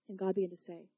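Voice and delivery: ordinary delivery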